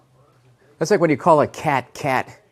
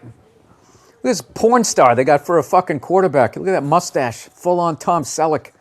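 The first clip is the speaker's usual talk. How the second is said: usual speaking voice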